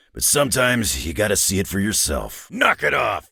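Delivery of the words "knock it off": sharp yell. Acted. Wolverine yelling at a fighter to stop, sharp yell. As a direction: snap them out of it with a yell.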